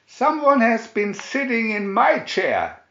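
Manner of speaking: Papa Bear bellowing about his chair; roaring Papa Bear. A narrator's reading. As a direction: roar it like an outraged father bear. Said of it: "roared Papa Bear".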